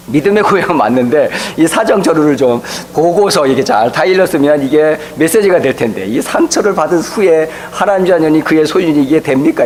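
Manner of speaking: ordinary talk, nothing marked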